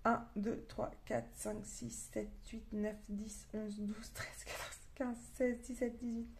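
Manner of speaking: soft, half-voiced count; counting to oneself. Real, not acted. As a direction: count under your breath, barely voicing it. Counting books to herself. (compter à mi-voix)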